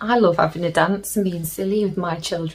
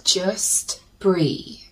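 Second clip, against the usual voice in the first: gentle storybook reading with slow pauses; soothing storybook narration for little listeners; reading to children; 2 semitones higher, slower than usual. A narrator's reading. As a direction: read it like a bedtime picture book. Reads picture book to children.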